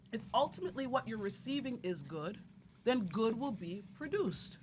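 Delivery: normal talking voice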